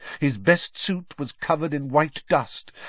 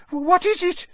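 much higher, gasped question; terrified gasp; 4 dB louder than usual. A narrator's reading. Aunt backed against the wall by noises in the fireplace, terrified gasp. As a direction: gasp it out in alarm. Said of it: "gasped Aunt Petunia".

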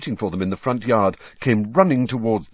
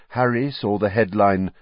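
usual speaking voice

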